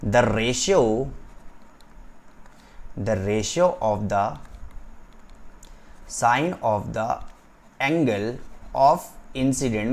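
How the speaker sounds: drawn-out words with long pauses; slow dictation. Shown as a demonstration. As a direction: dictate phrase by phrase for note-taking. Dictates a definition to write. (धीरे-धीरे लिखवाते हुए)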